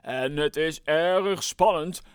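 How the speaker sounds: megaphone announcement. Acted. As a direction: announce the winners with suspense. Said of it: "begon weer te roepen door de megafoon".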